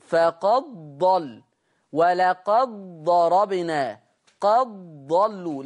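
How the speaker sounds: "فقد ضل" و"ولقد ضربنا" و"قد ضلوا" بلا أثر للدال; تلاوة بطيئة بضاد مشددة مكان الدال; إدغام الدال في الضاد. Shown as a demonstration. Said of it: "لا أثر للدال". (أمثلة ورش مدغمة ببطء)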